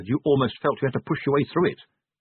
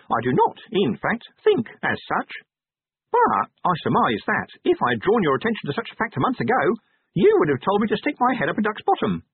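high-pitched, officious little imp; high, pedantic imp voice; 9 semitones higher than usual. Acted. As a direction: explain primly as a small creature. Imp's voice.

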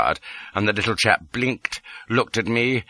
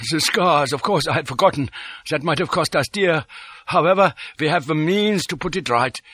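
higher-pitched character voice; vexed, then businesslike, pitched up; Detchard, annoyed villain; 7 semitones higher than usual. A narrator's reading. Detchard realises the scars were forgotten, annoyed.

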